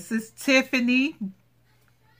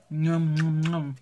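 low munching "nom nom" noises; mock munching, hummed low; playful eating noises; 4 semitones lower, slower than usual. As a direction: pretend to gobble it up. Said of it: "That's like eating".

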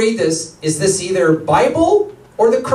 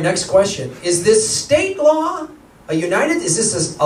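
ordinary talk, nothing marked

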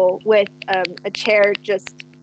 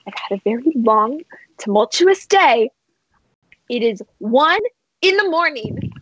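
strained, higher-pitched exasperated plea; weary exasperation; 4 semitones higher than usual. Acted. Ollie pleading for sleep at one a.m., exasperated. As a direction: plead for peace, worn out and fed up.

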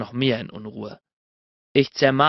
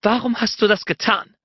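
higher, insistent woman's question; demanding; 8 semitones higher than usual. A narrator's reading. She demands why he did it, insistent. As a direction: press him on the du.

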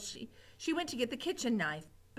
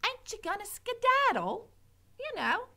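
put-on much higher, sing-song girl; high coaxing Mig; 12 semitones higher, 3 dB louder than usual. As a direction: urge the mouse to run. Mig coaxes mouse.

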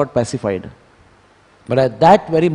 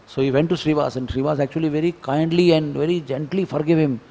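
ordinary delivery

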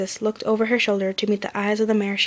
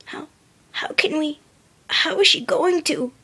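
trailing off; 7 semitones higher than usual. A narrator's reading. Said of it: "The Pegasus trailed off".

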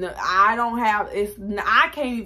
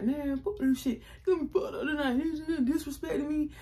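higher mock-angry guy voice, half laughing; imitating angry boyfriend; 7 semitones higher, 4 dB quieter than usual. Girlfriend mimics her boyfriend griping at night, mock angry. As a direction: play him fed up and sulky.

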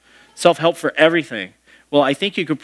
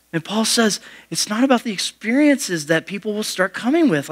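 usual speaking voice

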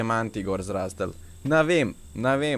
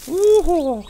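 high, loud "woo-hoo"; excited whoop; 7 dB louder than usual. Real, not acted. Excited whoop at own speed.

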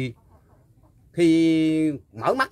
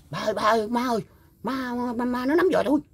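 retold cry for help; 9 semitones higher than usual. Old man reenacting calling his parents when the ghost grabbed him, high mock panic. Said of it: "Mới la lên, la lên".

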